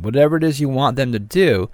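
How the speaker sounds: normal talking voice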